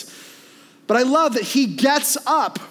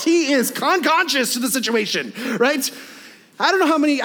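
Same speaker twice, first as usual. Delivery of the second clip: ordinary talk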